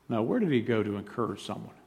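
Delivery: ordinary delivery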